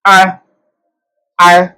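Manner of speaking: 'आ' said out clearly for the class; Swedish ä sound. Shown as a demonstration. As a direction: open the mouth on 'आ'. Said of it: "ये एक लफ्ज बनता है आ".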